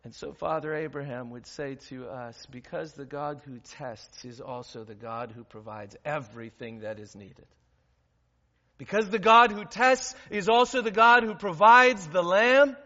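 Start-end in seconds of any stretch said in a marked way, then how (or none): 0.1-7.5 soft, lower intimate sermon voice; quiet preaching. Acted. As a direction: draw them in quietly.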